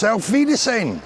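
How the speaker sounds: stern mock German farewell; German goodbye. Brit mocks German goodbye as menacing. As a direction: make it sound like a threat. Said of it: "sounds like you're gonna get shot".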